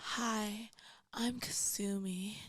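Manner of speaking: slow seductive whisper; sultry porn voice. Shown as a demonstration. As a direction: breathe it slowly and seductively. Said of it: "Do it again".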